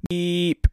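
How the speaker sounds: high held beep tone; spoken beep, held high; imitated beep sound. Shown as a demonstration. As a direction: mimic an electronic beep by mouth. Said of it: "after the beep".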